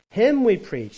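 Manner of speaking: loud, high proclamation; forceful preaching. Acted. Preacher proclaims the verse, loud and high. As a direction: proclaim it to the congregation.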